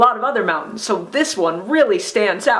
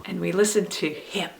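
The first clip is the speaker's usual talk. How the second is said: softer line settling on "him"; stress on HIM; 3 semitones lower, 7 dB quieter than usual. Shown as a demonstration. Teacher listing all three things to do, stress on "him". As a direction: finish with weight on "him". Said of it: "all three of those things".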